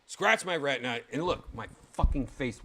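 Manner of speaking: normal talking voice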